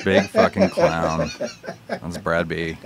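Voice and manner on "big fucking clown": higher, slow mocking drawl; slow high taunt. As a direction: stretch out FUCKING like a jeering clown. Repeats line in a put-on voice.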